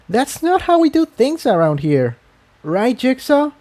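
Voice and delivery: put-on high, light voice of a timid young mare; a nervous pegasus looking for backup; young mare Tiptoe. A narrator's reading. Tiptoe appealing to Jigsaw against splitting up, high and uneasy.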